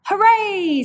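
high, loud cheer of joy; bright triumphant whoop; joyful cheer. Acted. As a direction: cheer it out like a happy donkey. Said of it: "said the donkey".

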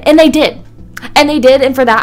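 excited. Real, not acted. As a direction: announce the win proudly. Poll win celebration.

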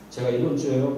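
normal talking voice

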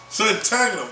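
usual speaking voice

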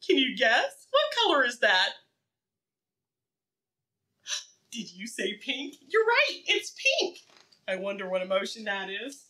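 normal talking voice

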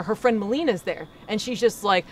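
plain reading voice

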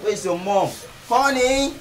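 high, carrying call of honey; calling out for wife. Acted. Father calls his wife, high and carrying. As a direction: call across the house for her.

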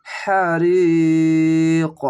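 হারিক very slow and loud, bouncing the end upward; echoing qalqala. Shown as a demonstration. Teacher demonstrating the qalqala in হারিক, loud slow recitation. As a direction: hold হারিক long, then bounce the last sound up. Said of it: "এভাবে হবে".

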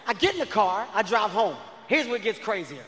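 ordinary talk

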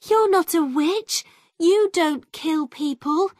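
high-pitched, insistent protest; crying out. A narrator's reading. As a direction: protest loudly, pitched well up. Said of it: "I cried".

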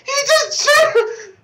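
the words dissolve into a squeal of laughter. Real, not acted. Vlogger retelling Cain's cheesy turn, squealing with laughter.

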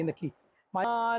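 usual speaking voice

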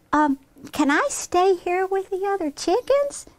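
put-on high, pleading little-girl question; hopeful child. Henrietta asking Barney to stay, hopeful child. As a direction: ask sweetly in a high child's voice.

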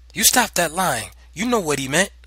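loud, high maternal rebuke; angry scolding. A narrator's reading. Mother scolds after hitting child.

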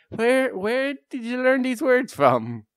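high, slow mock-puzzled parent voice; baffled parent. Parent puzzling over the kid's British words, high and slow. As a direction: ask it like a confused parent hearing strange words.

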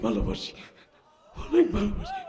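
high, tearful confession of love; choked-up devotion. Real, not acted. Preacher declaring love for the Prophet, choked up. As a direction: let it rise as if near tears.

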